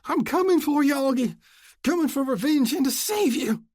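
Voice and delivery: high, loud maniacal scream. Acted. Oscar charges toward Luke screaming, maniacal. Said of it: "he screamed".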